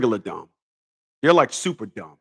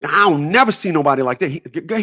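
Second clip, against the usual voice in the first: ordinary talk, nothing marked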